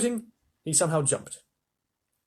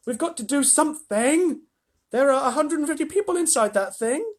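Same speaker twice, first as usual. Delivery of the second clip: higher, urgent cry; alarmed boy; 13 semitones higher, 4 dB louder than usual. A narrator's reading. Charlie cries out to Wonka, higher and alarmed. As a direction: call it out in alarm.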